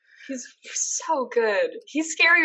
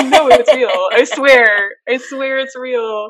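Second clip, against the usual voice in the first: loud, insistent protest through laughter; exasperated but amused insistence; laughing protest; 3 semitones higher, 9 dB louder, slower than usual. Real, not acted. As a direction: insist you are not making it up, laughing. Protesting that she invented nothing.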